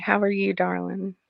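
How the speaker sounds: ordinary talk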